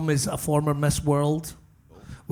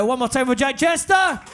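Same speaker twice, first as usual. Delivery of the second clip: calling out to crowd; 12 semitones higher than usual. Real, not acted. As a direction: hype up the room for the break.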